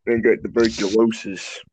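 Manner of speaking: low, slow put-on voice; sick character impression. Friend acts out a tuberculosis confession, low and slow. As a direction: drag the diagnosis out, low.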